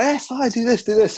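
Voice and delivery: high, bouncy eager voice; mock eager chirp. The grumpy brother mocking John's eagerness, chirping high. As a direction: chirp it up like an overexcited helper. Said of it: "Johnny's like".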